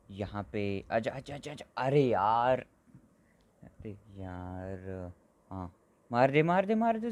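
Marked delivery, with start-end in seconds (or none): 6.1-7.1 high, urgent hit it; high excited hit it hit it; urging shot. Real, not acted. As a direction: egg on the shot.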